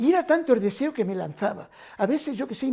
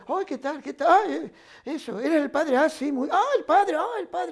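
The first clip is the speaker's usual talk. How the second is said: lightly voiced wounded soldiers, much higher; eager soldiers greeting; 6 semitones higher than usual. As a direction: mimic excited young men greeting the priest, pitched up. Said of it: "empezaban así los mutilados a hablar conmigo".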